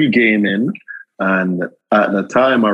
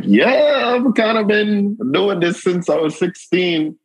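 much higher, eager reply; excited yes; 12 semitones higher than usual. Retold or imitated. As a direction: toss it off with eager pride. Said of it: "I'm like".

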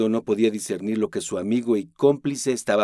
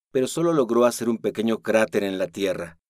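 plain reading voice

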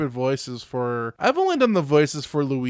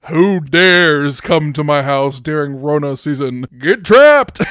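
spooky villain voice; 10 dB louder, slower than usual. Pretend. Acting spooky house owner.

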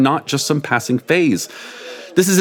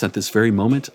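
plain reading voice

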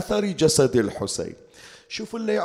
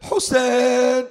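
higher, long mourning call; cry from the heart; 7 semitones higher, 4 dB louder, much slower than usual. A narrator's reading. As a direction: call the name high and drawn out, grieving. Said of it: "وإذا من قلبه صاح".

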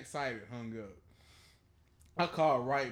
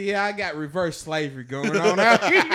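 loud, laughing delivery; joking to the lady in line; laughing joke; 4 semitones higher, 12 dB louder than usual. Retold or imitated. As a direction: crack the joke through laughter. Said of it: "I said".

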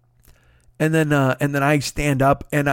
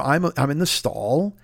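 usual speaking voice

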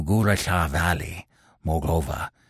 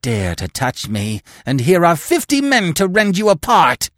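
much higher, louder screech; a cornered hillman threatening with his fifty men; shrill screeching threat; 11 semitones higher, 6 dB louder than usual. A narrator's reading. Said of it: "he screeched".

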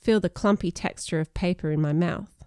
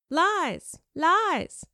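mock inner scream; 10 semitones higher than usual. Panicked brain contradicting the speaker, contained high scream. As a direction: cry each word high without real volume. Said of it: "my brain screamed".

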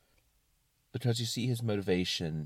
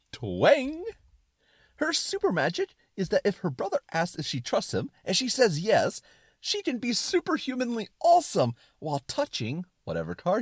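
high, loud, gleeful explaining with twangs; excited suspenders; 11 semitones higher, 4 dB louder than usual. Acted. Suspenders of disbelief explaining her super magic, excited and high. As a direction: gush the explanation, ringing out each twang. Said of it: "talk in an excited tone".

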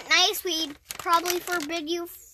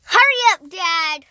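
impatient call; 9 dB louder than usual. Real, not acted. Kid urging dad.

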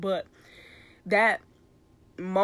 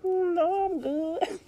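high voice; 11 semitones higher, slower than usual. Retold or imitated. Retold self declining, high.

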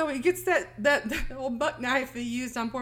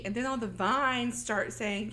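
ordinary delivery

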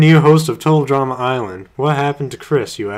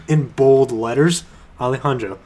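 usual speaking voice

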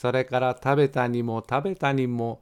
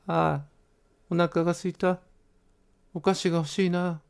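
put-on higher, wistful boy's voice, a bit quick at the end; a lightly voiced boy longing for sweets; hungry little boy; 7 semitones higher, faster than usual. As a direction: sigh it like a starving child. Hungry boy Goro wishes for sweets.

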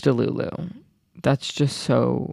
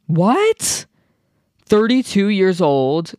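high, slow, incredulous what; can't believe the number; stunned disbelief; 5 semitones higher, much slower than usual. Real, not acted. Vlogger shocked to learn the influencer's age, high disbelief.

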